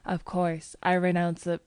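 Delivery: ordinary delivery, nothing marked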